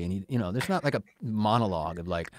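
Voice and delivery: ordinary talk, nothing marked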